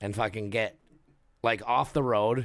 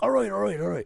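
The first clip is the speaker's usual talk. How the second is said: brother calming down; 9 semitones higher, faster than usual. Retold or imitated.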